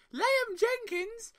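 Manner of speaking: Spike Eskin's fake English. Host imitating a fellow podcaster's impression of his English accent. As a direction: stretch the name out in an exaggerated English accent. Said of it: "Spike Eskin on Art of the Take would say".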